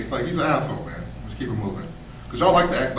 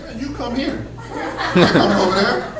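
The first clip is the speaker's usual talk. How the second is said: exasperated pleading; 7 semitones higher than usual. Retold or imitated.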